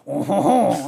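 loud, exaggerated French "oh"; joke French accent. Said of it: "French accent, they were worried it would".

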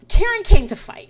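sudden high, loud hype; hyped up. Real, not acted. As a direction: sell the drama to the viewers, loud and high. Host hyping the fight to viewers.

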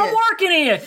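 echoed mock anger. Echoing the angry Wei Wuxian quote.

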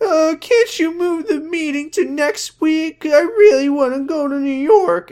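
very high, whiny mock sobbing; fake crying. Podcaster imitates fiancée begging to come along, pretend crying. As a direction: plead in a badly faked tearful squeal. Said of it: "No, she's pretending to cry".

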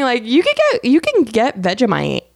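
ordinary talk, nothing marked

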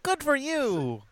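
high, slow, drawn-out cooing praise; mock supportive. Cohost plays classmate praising a beginner, mock supportive. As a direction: coo it with fake encouragement.

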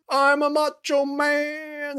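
mocking Sheridan as a macho man, slow and hammed-up; mock macho hero. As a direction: ham up the hero moment. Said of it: "Sheridan's like".

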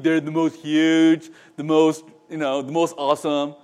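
loud, level-pitched braggart; lightly put-on self-important boasting; boastful impression. Preacher mocking successful people who think too highly, boastful impression. Returns to the Trump-like voice.

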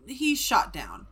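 ordinary talk

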